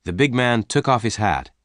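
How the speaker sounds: ordinary delivery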